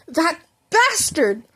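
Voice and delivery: slow bitter curse; angry growl. Acted. Bakugo cursing his rival at the roof edge, slow angry. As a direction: spit the insult out slowly. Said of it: "Bakugo glared angrily".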